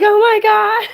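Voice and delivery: high, loud, laughing through tears; tearful oh my God. Retold or imitated. Retelling crying at the movie, high teary laughing outburst. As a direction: blurt it out between laughing and crying. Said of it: "I was crying throughout the movie".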